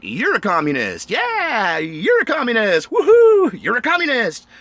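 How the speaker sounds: high gleeful taunt, sharply rising on the whoops; taunting singsong. Retold or imitated. Needling protesters with a high singsong taunt. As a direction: sing it out to mock them. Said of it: "I'm like".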